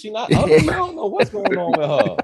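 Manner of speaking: high, laughing mock bewilderment; laughing mock family. Relatives baffled by the single woman, laughing high. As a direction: puzzle over her while cracking up. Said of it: "your family look at you like".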